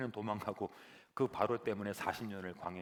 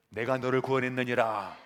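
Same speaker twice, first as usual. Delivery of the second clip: booming proclamation; 9 dB louder than usual. Acted. God proclaims loudly.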